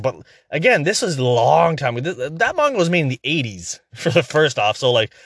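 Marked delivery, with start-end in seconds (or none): none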